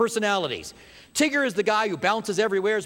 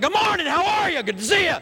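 high, loud mock-chirpy hello; overly cheery greeting; 10 semitones higher, 4 dB louder than usual. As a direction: shout it out like an annoying morning person. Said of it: "Some of you guys are like".

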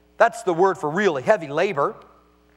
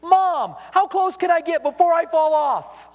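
put-on higher child's voice calling back across a distance; 9 semitones higher, slower than usual. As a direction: call out like an excited five-year-old. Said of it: "he yelled back at you".

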